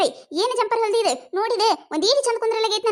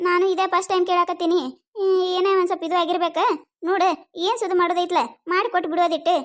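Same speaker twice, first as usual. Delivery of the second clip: ordinary talk, nothing marked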